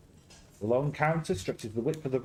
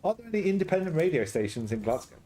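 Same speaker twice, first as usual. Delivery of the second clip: ordinary talk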